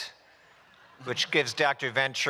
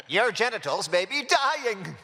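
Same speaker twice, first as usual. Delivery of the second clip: mock PSA read; 8 semitones higher than usual. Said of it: "record the P S A for testicular torsion".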